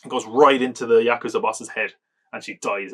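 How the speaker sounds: ordinary delivery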